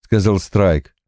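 usual speaking voice

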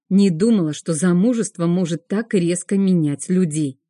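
ordinary delivery, nothing marked

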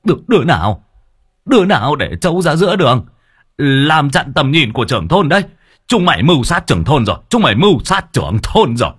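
loud, fast, panicked yelp; yelping in alarm. A narrator's reading. Village chief yelping at whoever left the buffalo. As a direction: cry out like a startled village chief. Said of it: "ông kêu lao oai oái".

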